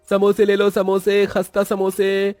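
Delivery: very high, level-pitched hawking chant; sustained high seller's call; street vendor's cry. Acted. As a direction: hawk snacks, stretching the last word out.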